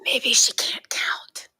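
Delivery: slow stage whisper to the audience. Acted. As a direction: lean in and whisper the joke slowly. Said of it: "I turn and whisper to an audience".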